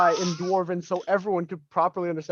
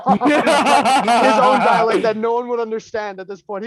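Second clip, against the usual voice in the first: laughing; 5 semitones higher, 7 dB louder, slower than usual. Real, not acted. Player laughing while describing dialect.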